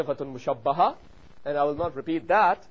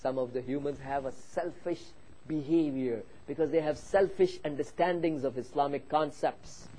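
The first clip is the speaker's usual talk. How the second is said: normal talking voice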